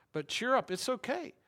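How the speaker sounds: mock-cheery reassurance; bright cheerful encouragement. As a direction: sell the cheer with a rising tune. Jesus quoted, upbeat.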